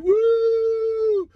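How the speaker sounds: high, loud cheering whoop; mock rally whoop; protest whoop. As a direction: cheer like at a march.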